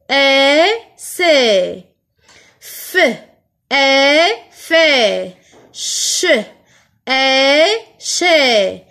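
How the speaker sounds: teacher's letter-by-letter blending with long è; each sound held separately, then the blended syllable; syllable blending demo. Shown as a demonstration. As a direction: sound out the consonant, stretch an open è, then join them. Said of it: "Comment je vais lire les consonnes avec le son E".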